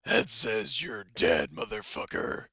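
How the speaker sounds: slow, loud villain's threat; menacing villain verdict. Acted. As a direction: draw out the death sentence like a comic-book villain. Voices Two-Face's coin-flip verdict.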